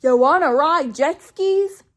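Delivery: hammed-up goofy boyfriend voice; mock dopey suggestion. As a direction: drawl it like a clueless guy. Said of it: "Jeremy's like".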